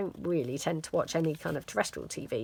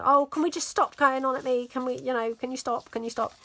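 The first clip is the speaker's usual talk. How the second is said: put-on higher, louder, whiny protest from a put-upon teen; offended daughter; 7 semitones higher, 4 dB louder than usual. Daughter snapping at mum to stop nagging, higher and whiny. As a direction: mimic her huffy, offended pleading. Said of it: "she gets all offended".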